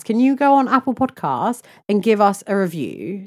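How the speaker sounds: usual speaking voice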